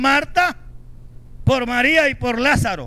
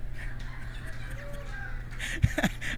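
high breathy laugh; 11 dB quieter than usual. Real, not acted. Laugh after story punchline.

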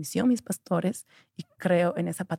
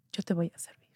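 soft, unvoiced whispered vow; 6 dB quieter than usual. Girl prays at dawn for her mother's life, whispered vow. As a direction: whisper the promise to God.

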